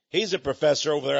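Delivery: ordinary delivery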